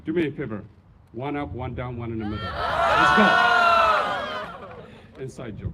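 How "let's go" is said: rallying shout. Real, not acted. Rallying the graduates.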